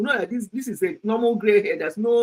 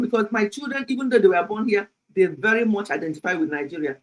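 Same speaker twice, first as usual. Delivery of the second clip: ordinary talk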